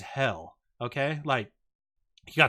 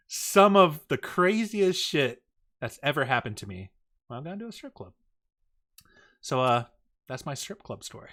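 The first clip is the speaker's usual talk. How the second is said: usual speaking voice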